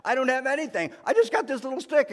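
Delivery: put-on high, loud little boy pleading; small boy voice. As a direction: play a small child holding out his stick. Boy offers God his stick.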